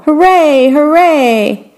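high, cheering shout; the audience cheering the brothers' show; cheering hooray. A narrator's reading. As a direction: cheer like a happy crowd. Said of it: "the audience shouted".

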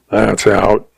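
usual speaking voice

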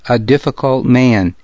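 usual speaking voice

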